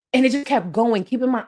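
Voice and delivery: ordinary delivery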